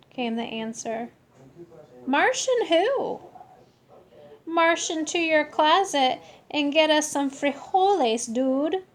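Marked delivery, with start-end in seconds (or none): 2.0-3.2 put-on puzzled kid voice. Knock-knock style reply. 4.4-8.8 alien voice. Acted. Martian voice from comet.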